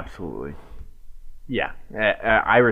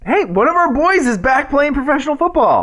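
excited; 12 semitones higher, 3 dB louder than usual. Real, not acted. Excitedly introduces friend of show.

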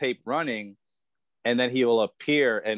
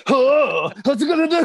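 high, loud mock shouting; Japanese master impression; 8 dB louder than usual. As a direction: imitate a comic's shouted master act. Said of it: "impression of a Japanese master".

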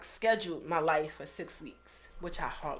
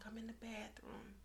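whispered, very soft and higher; retold hushed confession; 4 semitones higher, 14 dB quieter than usual. Answering best friend while crying at work, hushed confession. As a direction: whisper it as if hiding. Hiding in bathroom, crying, on phone.

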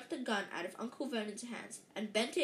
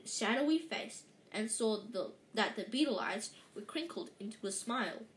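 plain reading voice

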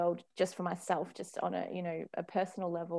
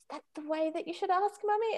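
put-on higher, sing-song reminder to a child; playful parental prompt; 11 semitones higher than usual. Mother reminding her child how to ask nicely, sing-song. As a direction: nudge the child to mind their manners.

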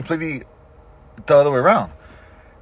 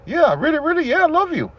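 much higher, rushed insisting he means it; insistent reassurance; 13 semitones higher than usual. Retold or imitated. Husband insisting to wife he loves her, high eager pleading. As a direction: pile on the reassurance, pitched up high. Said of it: "And I'm like".

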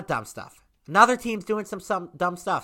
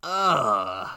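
ordinary delivery

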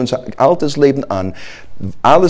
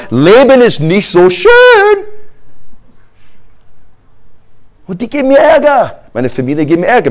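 higher, whiny litany of grievances; complaining believer; 6 semitones higher than usual. Pretend. Believer blaming God for his troubles, whining. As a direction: list everything that went wrong, self-pitying.

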